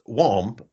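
"womp" said with a short open o; "womb" rhymed with bomb. Shown as a demonstration. Co-host demonstrating the other pronunciation, "womp" with a short o. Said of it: "went the other way and went".

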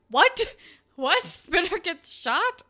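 loud, high re-enacted shock; shocked disbelief. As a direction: blurt it out in hammed-up disbelief. Re-enacting own shocked reaction.

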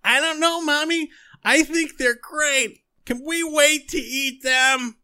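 put-on very high, loud, slow childish whine; pleading monster child. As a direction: beg mommy like a monster kid. Monster child answers its mother.

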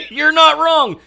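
high laugh into the words. Real, not acted. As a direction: laugh while agreeing. Laughing at the joke.

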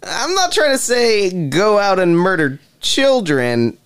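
high, slow mimicry of a protest; mocking echo. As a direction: parrot the denial back high and drawn out. Interrogator parrots the suspect's protest.

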